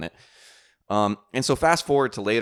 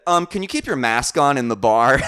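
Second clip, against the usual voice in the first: loud, higher-pitched mock scolding; rude demand; 6 semitones higher, 9 dB louder than usual. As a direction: imitate an indignant telling-off. Acts the rude woman's demand.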